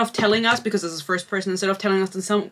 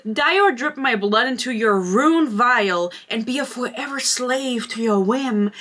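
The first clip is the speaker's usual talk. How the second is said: mock dramatic reading; 4 semitones higher, slower than usual. Reading the book's dialogue mockingly.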